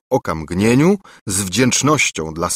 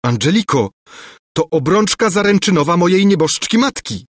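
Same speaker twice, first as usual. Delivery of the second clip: shouting; 8 semitones higher than usual. A narrator's reading. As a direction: cry out in dismay. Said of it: "krzyknął Lulejka".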